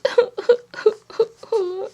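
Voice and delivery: high, hammed-up fake sob-laugh; playful mock crying; pretend cry. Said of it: "and do the pretend".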